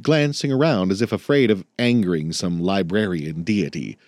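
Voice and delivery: ordinary delivery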